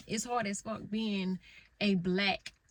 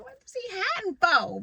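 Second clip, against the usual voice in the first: mock surprised; 11 semitones higher, 8 dB louder than usual. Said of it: "I was like".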